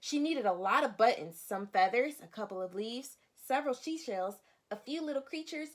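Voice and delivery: expressive picture-book narration for a class; storybook read-aloud. Acted. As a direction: lively, clear reading to young children. Teacher reads picture book aloud.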